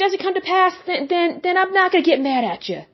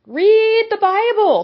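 higher and slow, pressing hard; raised urgent command; 7 semitones higher, slower than usual. Real, not acted.

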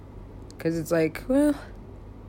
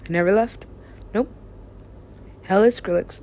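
ordinary delivery

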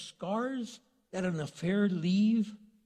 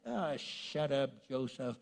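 dismissive mockery; 4 semitones lower, 6 dB quieter, slower than usual. Brothers mock Joseph.

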